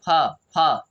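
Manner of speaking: "फ" alone, short fa with an uh vowel; isolated syllable sound. Shown as a demonstration. Teacher breaks down the word first, "फ" as separate syllable. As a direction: sound out "फ" by itself, pausing after each. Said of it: "असर आ रहा है अ".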